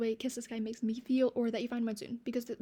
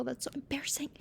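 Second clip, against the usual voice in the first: whispered aside, a little mortified; a sheepish whispered aside; embarrassed whisper; 3 semitones higher, 3 dB quieter than usual. Real, not acted. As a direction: breathe it out under your breath. Aside about crying in class.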